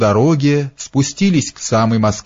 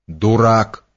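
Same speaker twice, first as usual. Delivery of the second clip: ordinary delivery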